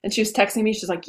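normal talking voice

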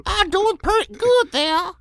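very high, loud squeaky mock-girl voice; Muriel. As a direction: squeal it as a caricature schoolgirl. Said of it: "You are Muriel".

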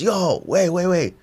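high, eager yo wait wait; excited recognition. Retold or imitated. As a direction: drunk suddenly starstruck. Drunk recognizes him mid-fight.

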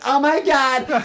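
high, loud mock scream; hammed-up bartender's shriek; shocked outcry. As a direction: wail it in exaggerated alarm.

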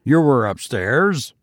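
lightly growled question; a touch of menace in the question. A narrator's reading. Tussman questions his pale servant, with a mild growl. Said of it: "growled Tussman".

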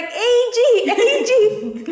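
high, laughing parody of a raspy yell; mocking her voice. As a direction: copy her bullhorn call for a joke. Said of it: "mocking my voice".

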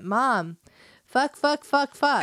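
put-on high, loud little-boy chant of curses; little boy cursing. Son repeating swear words to mom, high and loud. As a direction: play the five-year-old testing a bad word. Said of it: "he went".

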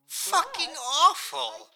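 high, loud groaning disgust, drawn out; theatrical disgust. Acted. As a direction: recoil in exaggerated horror at a terrible show. Disgust at the show playing.

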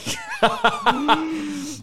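high, shrieking laugh; burst of laughter. Real, not acted. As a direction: let the laugh explode out of you. Laughing at a viewer's joke.

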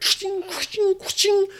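high ringing imitation of clashing blades; sword clash noises. Storyteller sounding out the sword fight, high clashing noises. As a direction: make steel ring, high and quick.

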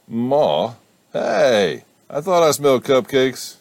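high, loud eager call; excited. Acted. Son calling to his mother over cupcakes, excited.

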